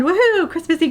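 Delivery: high-pitched woohoo; excited cheer. Real, not acted. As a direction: squeal it with glee. Announcing the Christmassy conclusion.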